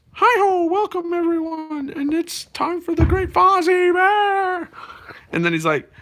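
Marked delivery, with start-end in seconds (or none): 0.1-4.7 much higher frog puppet voice, slowing to the end; high, nasal-free cheery frog host; Kermit voice. Retold or imitated. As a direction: welcome the crowd like Kermit. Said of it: "And so he goes".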